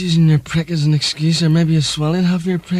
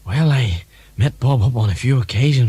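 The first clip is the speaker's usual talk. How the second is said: Ronald Reagan impression; 5 semitones lower than usual. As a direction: play a drowsy, forgetful Reagan. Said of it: "Mister Reagan".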